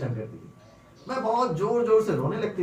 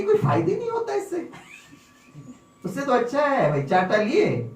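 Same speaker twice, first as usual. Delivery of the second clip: put-on high, laughing little-girl voice; cheeky little girl; 5 semitones higher, 3 dB louder than usual. Girl to Swami, explaining her easy method, high and laughing. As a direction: play the girl pleased with her trick. Child's voice retold.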